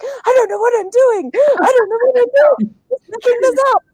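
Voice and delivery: high, rushed mock panic, half laughing at herself; panicked inner voice. Director recalling her own rehearsal panic, mock frantic. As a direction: replay the director's secret panic, hammed up. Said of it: "the whole time I was like".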